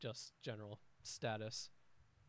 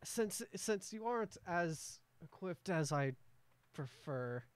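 merchant's voice; 5 semitones higher, 3 dB louder than usual. Acted. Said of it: "he's, like, trying to".

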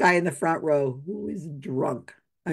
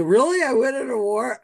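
put-on louder, higher, thrilled drunk disbelief; drunk delight; 5 semitones higher, 5 dB louder than usual. Drunk man thrilled by host's award, loud delight. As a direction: sell the surprise of winning. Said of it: "Okay, so".

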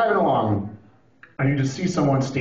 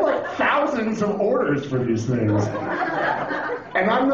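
usual speaking voice

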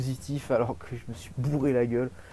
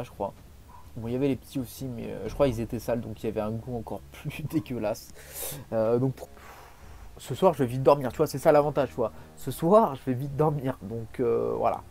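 ordinary talk

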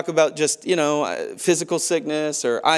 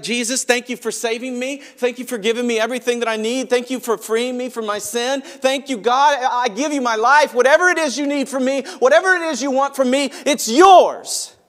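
high, rising, impassioned prayer building to a cry; fervent prayer; 8 semitones higher, 4 dB louder than usual. Pretend. Worshipper praying aloud in church, fervent and rising. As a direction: pour it out to God, climbing higher.

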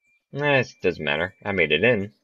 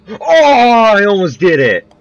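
loud high excited cry; excited shout; 12 dB louder than usual. Real, not acted. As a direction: shout it, thrilled it nearly worked. Near success on conveyor belt.

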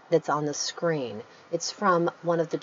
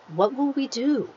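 mildly fearful; 8 semitones higher than usual. Shown as a demonstration. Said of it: "much better to use action".

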